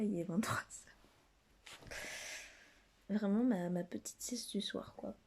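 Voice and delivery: hushed late-night whisper. Real, not acted. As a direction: whisper so nobody wakes up.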